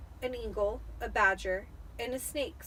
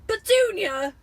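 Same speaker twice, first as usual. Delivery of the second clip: much higher, loud shocked cry; gasped; 11 semitones higher, 12 dB louder than usual. Acted. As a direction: choke out his wife's name in shock. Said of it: "he gasped".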